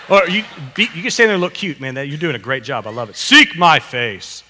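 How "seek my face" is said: louder, slow, firmly projected line; firm modelling. Shown as a demonstration. Pastor cheering on a child with the line, firm and slow. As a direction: say it out slowly and strongly.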